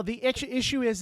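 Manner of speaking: ordinary delivery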